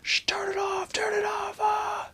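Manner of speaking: frantic high hushed cry, then a yelp; squeaky panicked hush ending in a yelp; mock panic. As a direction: panicky whisper-shout to kill the TV. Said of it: "he's, like, freaking out".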